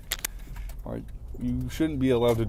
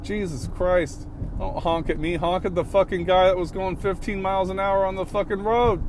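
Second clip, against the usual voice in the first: higher pitched fed-up ranting; higher, exasperated rant at the honking driver; 7 semitones higher than usual. Real, not acted. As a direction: still fuming, throw it at them. Still fuming at other driver.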